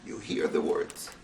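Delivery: normal talking voice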